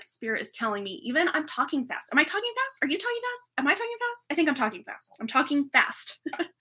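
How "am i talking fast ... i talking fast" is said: high, playful singsong questions; mock question. Tarot vlogger teasing herself about her speed, high and playful. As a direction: toss it up high like a tease.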